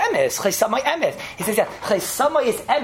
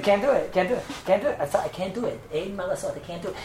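meek refusal; 5 dB quieter than usual. Retold or imitated. Woman refusing to spit on rabbi.

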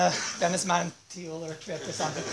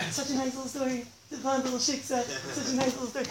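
soft, mock-innocent cooing; sarcastic sweetness; 3 semitones higher, 9 dB quieter than usual. Storyteller mocks the children's tale, sarcastic sweetness. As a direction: drop to a small, falsely sweet voice.

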